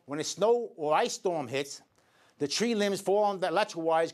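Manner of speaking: usual speaking voice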